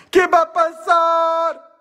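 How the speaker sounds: high, slow, fretting voice; hammed-up worried whine; mock worrier. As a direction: whine it like an anxious listener. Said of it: "que tanto piensan".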